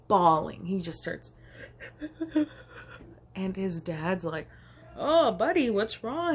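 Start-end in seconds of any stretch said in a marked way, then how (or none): 5.0-6.3 higher, soothing concern; comforting dad. Retold or imitated. Dad hugging his bawling son, gently concerned. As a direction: console a crying toddler.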